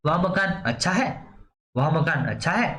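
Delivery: "अच्छा है" rising at the end like a question; question intonation. Shown as a demonstration. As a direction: ask it by tune alone, lifting "अच्छा है". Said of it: "अब इसी में आप प्रश्नवाचक बना सकते हो". ("अच्छा है" पर प्रश्नवाचक चढ़ता सुर)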